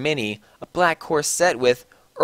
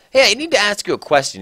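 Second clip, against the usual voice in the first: put-on a quick, higher old wizard asking; Dumbledore voice; 7 semitones higher, 5 dB louder, faster than usual. A narrator's reading. Dumbledore quickly asking Ebony about the concert, higher and fast. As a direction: fire off the question fast. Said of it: "he said".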